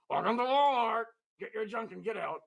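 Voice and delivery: high puppet voice, brisk; rude greeter. Acted. Fired Walmart greeter dog retelling his line, rude. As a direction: bark it at customers as a rude greeter.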